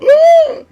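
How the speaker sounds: sharp high gasp of fright; startled gasp. Retold or imitated. Passenger in the car gasping in sudden fright. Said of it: "you hear her just go".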